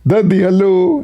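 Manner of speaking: put-on high, tiny voice of an unborn baby. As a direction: coo it like a little baby greeting dad. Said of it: "You can say".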